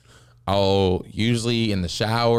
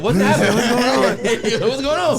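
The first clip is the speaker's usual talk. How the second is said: high, laughing mock alarm; leaning-in shock; 10 semitones higher, 5 dB louder than usual. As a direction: gasp out a high startled what's happening. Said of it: "You lean in".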